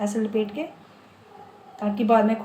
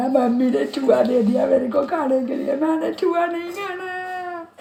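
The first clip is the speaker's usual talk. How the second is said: put-on high, whiny child protest, slowing at the end; mock-wail like a little girl refusing food; imitating crying child; 6 semitones higher, 4 dB louder than usual. Retelling to viewers how she cried over karela, imitated whiny child. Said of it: "मैं बहुत रोई थी".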